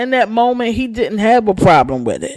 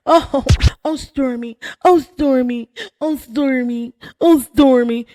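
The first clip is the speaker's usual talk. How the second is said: mock consoling; 5 semitones higher than usual. Vlogger mocking Marsau's fake comfort, sugary sing-song. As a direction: coo the name over and over like a phony comforter.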